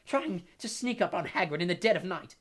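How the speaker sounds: loud, high outburst of rage; enraged. A narrator's reading. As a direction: rant furiously, struggling to speak. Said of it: "difficulty talking due to rage".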